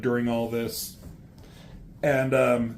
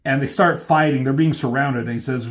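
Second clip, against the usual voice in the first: plain reading voice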